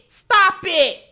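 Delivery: usual speaking voice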